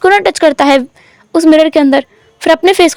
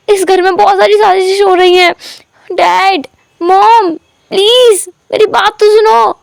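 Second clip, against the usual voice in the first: raised, begging voice to his parents; shouting, pleading; 6 semitones higher than usual. A narrator's reading. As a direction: beg to be heard, yelling. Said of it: "wing चिल्ला चिल्ला कर बोल रहा था".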